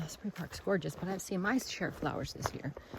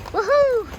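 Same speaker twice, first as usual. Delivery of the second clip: much higher, louder whoop; woohoo; 12 dB louder than usual. Real, not acted. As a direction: whoop it out to the valley. Cheering at the destination.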